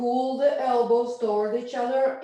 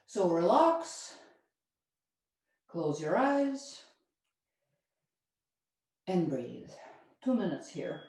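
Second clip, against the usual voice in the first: guided relaxation; 4 semitones lower, 6 dB quieter than usual. Acted. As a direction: ease the class into stillness. Yoga teacher guides relaxation.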